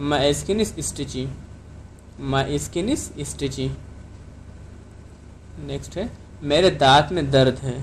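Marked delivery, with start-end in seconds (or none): 0.1-3.7 slow, clear model sentence for learners; careful classroom dictation pace; slow teaching model. Shown as a demonstration. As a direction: say it slowly so students can repeat. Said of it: "आप इसको कैसे कहेंगे?".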